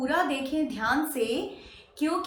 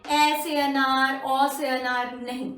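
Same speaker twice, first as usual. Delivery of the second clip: ordinary talk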